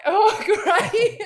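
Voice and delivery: rueful laugh through the words. Real, not acted. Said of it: "And then I started losing it".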